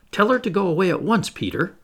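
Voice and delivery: high, quick urgent plea; urgent cry. A narrator's reading. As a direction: cry it out in a rush. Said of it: "the three cried simultaneously".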